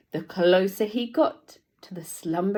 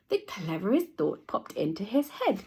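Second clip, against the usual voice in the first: usual speaking voice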